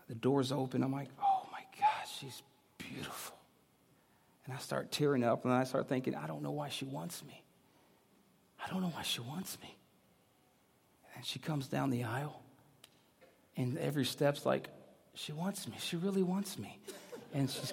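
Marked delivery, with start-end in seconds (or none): 1.2-3.3 awestruck whisper. Retold or imitated. Retold awe at seeing the bride. 6.1-9.7 very soft inner thought; hushed disbelief. Retold or imitated. Retold tearful thought at the wedding. 15.1-16.8 soft, amazed inner voice; hushed wonder. Retold or imitated. Retold amazed thought at each step.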